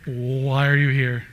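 slow, drawn-out "Why are you here?"; mock puzzlement. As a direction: stretch out WHY as if baffled by them. Puzzled people in heaven, acted.

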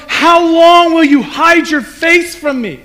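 drawn-out anguished question; pleading lament. Retold or imitated. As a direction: stretch out the cry to God. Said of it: "Like, like".

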